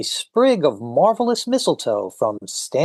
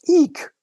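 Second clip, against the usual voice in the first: high squeaky eek; mock shriek; 3 dB louder than usual. Host voicing a startled swimmer, high mock shriek. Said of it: "a woman in the water goes".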